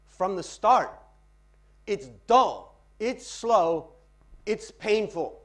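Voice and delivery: loud, forceful recital in short phrases; dramatic poetry reading. Shown as a demonstration. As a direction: hammer each phrase, pausing between them. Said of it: "Practice reading poetry".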